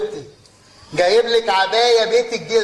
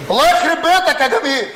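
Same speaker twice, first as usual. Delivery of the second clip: angry shout; 8 semitones higher than usual. Acted. Father yelling at his son over the customs bill, furious shout. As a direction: explode at him, half laughing.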